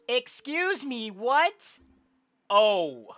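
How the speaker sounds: slow, loud, high-pitched shout; drawn-out, very loud outburst; exasperated shouting. Acted. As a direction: blow up the repeated line into a shout. Stuck in the repeated dialogue loop.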